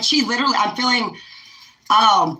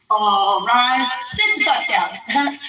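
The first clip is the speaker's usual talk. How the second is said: mock courtroom call; much slower than usual. Vlogger mimicking a courtroom clerk ordering the room to rise and sit. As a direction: play a court clerk ordering everyone seated. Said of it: "like the Courage Girls".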